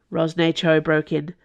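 ordinary delivery, nothing marked

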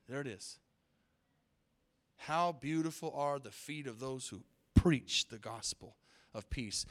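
soft, reverent scripture reading. Acted. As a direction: read the verse softly, like a treasure. Reads scripture softly.

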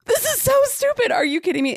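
high, loud mock outrage at a lame monster; exasperated complaint. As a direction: throw up your hands in disbelief. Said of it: "I'm like".

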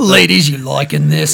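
slow, lightly put-on Cockney accent; a playful Cockney accent offered as a joke. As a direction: chat up the ladies in a mock Cockney accent. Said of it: "I can talk in a Cockney one".

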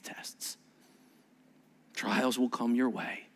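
low, soft, unhurried counsel; hushed, solemn reassurance; tender warning. Retold or imitated. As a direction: speak gently as a teacher to struggling followers. Jesus gently warning the weak disciples.

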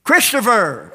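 high shepherd's call; shepherd calling sheep. Acted. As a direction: holler the name out to the flock. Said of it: "the shepherd comes to the sheepfold and says".

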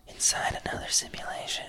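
breathless unvoiced hush, eerie aside; whisper. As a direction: whisper it like a secret twist. Trailing spooky aside.

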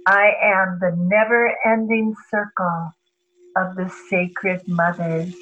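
calm mantra fading down; reciting mantra. Acted. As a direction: recite it like a prayer.

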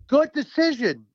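punched-up, high quote of an all-caps message; written in all caps. Retold or imitated. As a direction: read it out as if in capital letters. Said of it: "you know, in caps".

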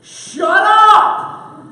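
loud sharp yell of shut up; shouting. Retold or imitated. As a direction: shout it at full force. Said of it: "the common refrain of the warehouse".

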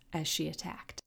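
ordinary delivery

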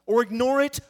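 ordinary talk

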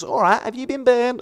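high-pitched Australian nurse voice; imitated Australian nurse. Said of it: "she was an Australian nurse".

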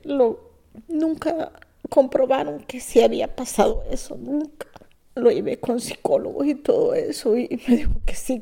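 ordinary talk

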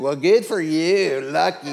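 dropped-down, insincere praise; mock congratulation. Husband icking his wife's wow, mock congratulation. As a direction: exaggerate the grudging drop after her excitement.

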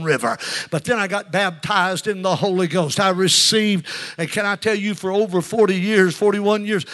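loud, driving revival delivery; fervent testimony preaching. Real, not acted. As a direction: press on with mounting fervor. Preaches his testimony at full force.

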